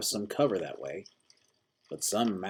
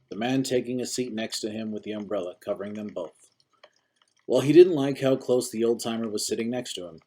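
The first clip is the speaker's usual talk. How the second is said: ordinary delivery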